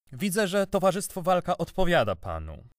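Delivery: high-pitched, mannered polite irony; subtle irony. A narrator's reading. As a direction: open with arch, over-courteous irony. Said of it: "zagaił z subtelną ironią".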